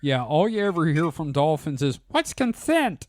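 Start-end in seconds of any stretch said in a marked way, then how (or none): 2.1-3.1 dolphin voice. Pretend.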